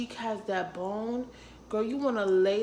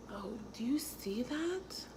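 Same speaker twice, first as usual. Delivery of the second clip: hushed voiceless aside; whisper; 4 semitones higher, 6 dB quieter than usual. Real, not acted. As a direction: breathe it out like a secret. Showing her contour, hushed.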